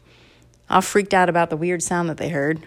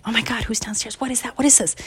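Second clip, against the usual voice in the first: mildly panicked, higher hushed questions; a touch of hushed alarm, pitched up; alarmed parents; 4 semitones higher, 5 dB quieter, faster than usual. Acted. Parents upstairs hearing a squeak, mildly alarmed. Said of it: "they were up in their room thinking".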